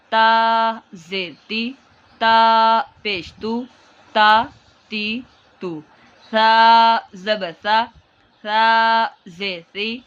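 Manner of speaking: با زبر با, stretched and emphatic, Arabic sounds; slow spelling drill. Shown as a demonstration. Teacher drills students on letters with Arabic pronunciation. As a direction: chant each letter-vowel pair slowly. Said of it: "عربی لہجہ میں پڑھیں گے".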